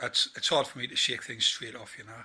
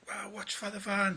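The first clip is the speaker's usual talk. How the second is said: mock warning; 8 semitones higher, 4 dB quieter than usual. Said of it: "I was like".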